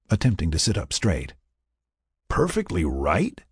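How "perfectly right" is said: teasing. A narrator's reading. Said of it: "he teased".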